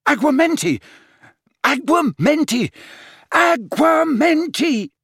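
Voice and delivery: high, loud spell shouts growing slow; frantic shouts. A narrator's reading. Harry frantically shouting spells at the goblet. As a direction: hurl the spell again and again.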